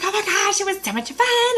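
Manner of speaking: high and bubbly; telling her husband about the class, high and thrilled; thrilled gushing. Retold or imitated. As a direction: gush like it was the best night ever.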